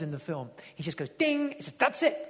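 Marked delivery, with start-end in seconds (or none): 1.1-1.5 high, bright chime imitation; ping it out like a computer; mock ding sound. 1.7-2.2 higher, pleased exclamation; mock triumphant. [name] triumphant in retelling.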